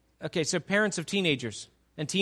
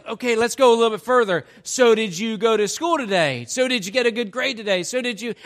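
loud, rapid-fire grilling parent; pushy parent questions; 3 semitones higher, 6 dB louder than usual. Retold or imitated. Pastor imitating parents grilling their teen, loud rapid questions. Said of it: "twenty questions that the parents are throwing".

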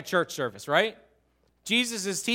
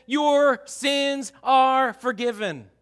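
slow, deliberate words of Jesus; solemn pronouncement; 4 semitones higher, 5 dB louder, much slower than usual. Acted. Jesus to the paralysed man, slow and solemn. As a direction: say it slowly like a blessing. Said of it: "he looks at them and he says".

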